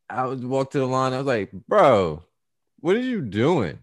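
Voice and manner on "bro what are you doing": exasperated. Retold or imitated. Confronting friend at register.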